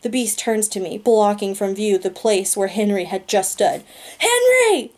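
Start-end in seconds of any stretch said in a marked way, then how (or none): 4.2-4.9 very high, loud cry of a name; yell. Acted. Narrator yelling for Henry after he vanishes, very high and loud. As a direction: shout his name in despair. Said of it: "I yell".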